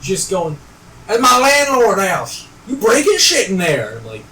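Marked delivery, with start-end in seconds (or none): 1.1-3.9 loud, high imitation of an angry man yelling; retold screaming. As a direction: yell it like the man with the carver. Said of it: "He's just screaming at me".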